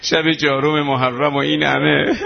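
usual speaking voice

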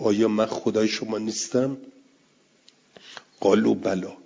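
normal talking voice

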